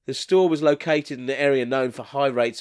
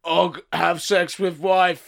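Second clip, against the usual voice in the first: slow caveman talk; 3 semitones higher, much slower than usual. Acted. Caveman explaining his need to the assistant, caveman voice. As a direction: plod through it like a caveman.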